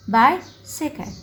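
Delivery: बाइसिकल split with a pause after बाइ; बाइसिकल slowly in separate pieces; syllable by syllable. Shown as a demonstration. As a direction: break up बाइसिकल, बाइ then सिकल. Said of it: "दरमियान में dots लगाने हैं".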